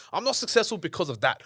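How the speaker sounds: high, loud whiny blame-shifting; mock excuse-making. Speaker mimicking people who blame others, mock complaining. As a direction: parody someone blaming everyone else.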